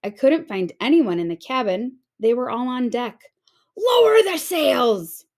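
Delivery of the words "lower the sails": loud, high, slow shout over the storm. A narrator's reading. Captain shouts orders in the storm, loud high. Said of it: "Braidbeard shouted".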